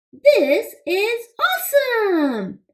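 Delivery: excited exclamation. Shown as a demonstration. Teacher shows children how an exclamation sounds, excited. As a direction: exclaim it with big enthusiasm. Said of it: "We want it to be read with a lot of emotion".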